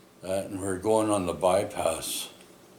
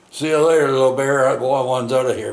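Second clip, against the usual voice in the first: loud, much higher cocky send-off; mock farewell; 6 semitones higher, 11 dB louder than usual. Kid pretending to bail from the truck, loud cocky farewell. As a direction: fling it out loud and high like you're already leaping. Kid joking about bailing out.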